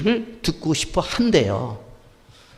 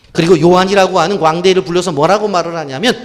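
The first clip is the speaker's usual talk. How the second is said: forceful preaching; 4 semitones higher, 8 dB louder than usual. Acted.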